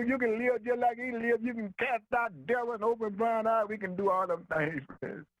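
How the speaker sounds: high-pitched, driving sermon delivery; impassioned preaching. Real, not acted. Preacher urging the congregation to claim their power, impassioned preaching. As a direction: preach it high and insistent, stretching key phrases.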